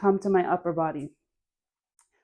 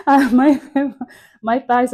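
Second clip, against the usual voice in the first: laughing; 7 semitones higher, 13 dB louder than usual. Real, not acted. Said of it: "laughs at forgotten detail".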